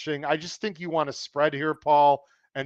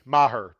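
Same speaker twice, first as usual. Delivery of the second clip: "Maher" with the accent up front; stress on first syllable; 6 dB louder than usual. Shown as a demonstration. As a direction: say "Maher" as MA-her. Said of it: "accent on the first syllable".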